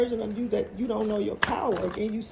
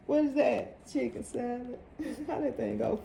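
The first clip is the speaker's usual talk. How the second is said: ordinary talk, nothing marked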